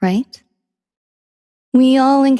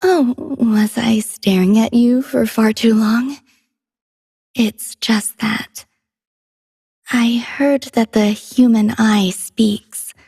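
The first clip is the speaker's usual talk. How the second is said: bashful, halting; slower than usual. Acted. Caught staring, explains herself haltingly.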